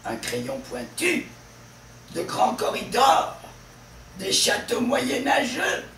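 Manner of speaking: final "tu" of "pointu", "dors" of "corridors", "geux" of "moyenâgeux" punched; stress on last syllable. Shown as a demonstration. Teacher showing stress in word groups, endings of "pointu", "corridors", "moyenâgeux" hit hard. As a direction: build up high and loud onto the endings of "corridors" and "moyenâgeux". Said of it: "le stress est toujours sur la dernière syllabe".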